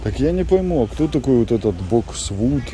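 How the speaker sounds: ordinary delivery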